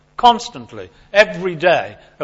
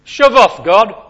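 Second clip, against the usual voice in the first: loud acronym; 6 semitones higher, 7 dB louder, much slower than usual. Shown as a demonstration. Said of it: "Sin, S, shove off God".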